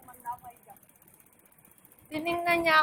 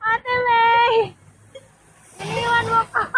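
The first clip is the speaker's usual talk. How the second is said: calling out; 8 semitones higher, 7 dB louder than usual. Real, not acted. Calling after friend, laughing.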